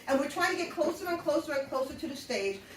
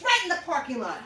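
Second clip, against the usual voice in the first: ordinary talk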